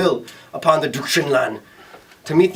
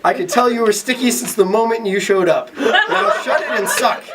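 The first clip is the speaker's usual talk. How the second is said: laughing; 7 semitones higher than usual. Acted. Sam commands.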